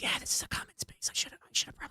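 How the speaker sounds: whispered, awkward reply; hushed aside. Acted. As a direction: whisper it back, sheepish. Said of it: "looks at you and goes".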